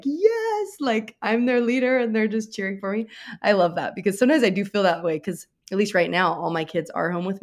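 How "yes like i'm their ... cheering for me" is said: a high, lightly hammed-up triumphant Yes; mock triumphant. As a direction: play the proud leader, half joking. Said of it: "it's like".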